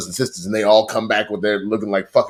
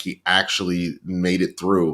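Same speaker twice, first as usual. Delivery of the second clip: ordinary talk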